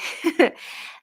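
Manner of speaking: short unvoiced laugh; breathy laugh. Real, not acted. Vlogger laughing at her own joke, breathy laugh.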